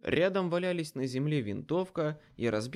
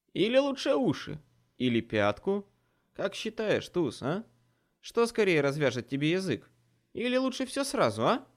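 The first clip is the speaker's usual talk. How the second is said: taunting; 7 semitones higher, 5 dB louder than usual. A narrator's reading. Dresden taunts captive.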